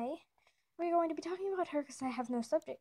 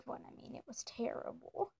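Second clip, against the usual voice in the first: hushed, embarrassed whisper; whispered aside; 8 dB quieter than usual. Real, not acted. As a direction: whisper it like a confession. Confides the last one was bad.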